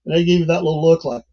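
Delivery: ordinary delivery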